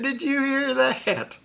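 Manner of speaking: high, mocking teasing voice with a laugh in it; jeering prankster egging the crowd on; mocking buddy. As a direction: crow about it to everyone, high-pitched and gleeful. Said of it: "He said".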